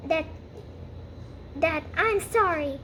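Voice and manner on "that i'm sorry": plain reading voice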